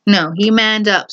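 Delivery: ordinary delivery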